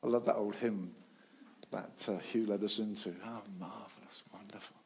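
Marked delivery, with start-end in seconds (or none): 3.2-4.8 much softer, reverent; hushed awe. Real, not acted. Preacher recalling the hymn in a reverent hush. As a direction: hush it in wonder.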